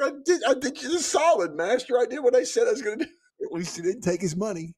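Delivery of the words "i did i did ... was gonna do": higher, louder and quick protest; hammed-up indignation, pleading his case; a put-upon god insisting he kept his word; mock indignant god. Voicing the god's defence.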